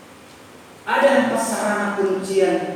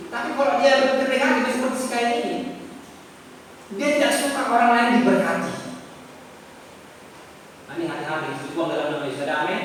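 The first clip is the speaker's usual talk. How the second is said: ordinary talk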